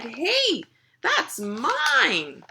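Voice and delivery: lightly voiced girl claiming what's hers; protesting girl. Girl protests as sandwich is taken.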